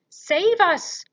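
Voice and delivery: high, very loud cry for help; scream. A narrator's reading. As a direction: scream in terror for rescue. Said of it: "screamed the flesh lump eater".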